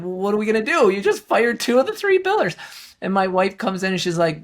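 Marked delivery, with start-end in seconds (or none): 0.0-2.5 panicked. Retold or imitated. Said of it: "shaking and going".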